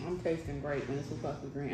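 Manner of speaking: talking with mouth full; muffled behind hand; chewing with hand over mouth. Real, not acted. Seen 5: Left speaker chews with hand covering mouth while talking.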